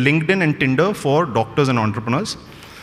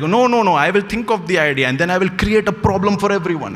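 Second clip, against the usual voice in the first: self-mocking stubborn reply; 6 semitones higher than usual. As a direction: play the cocky inventor who won't listen. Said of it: "I'm like".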